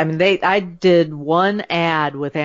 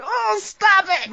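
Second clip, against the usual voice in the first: much higher, slow, squeamish "oh, stop it"; squeamish audience; much slower than usual. Retold or imitated. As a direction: play grossed-out listeners begging her to stop. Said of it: "Half of your audience is now going".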